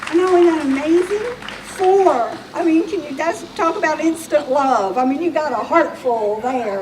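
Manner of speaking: genuinely thrilled, almost squealing; delighted amazement. Real, not acted. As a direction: gush about how wonderful it is. Marvels at big adoptive families.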